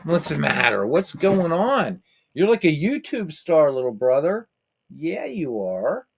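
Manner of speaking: high sing-song talk to the cat; pet voice. Real, not acted. Cooing to the cat on camera.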